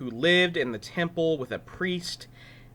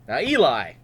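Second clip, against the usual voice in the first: plain reading voice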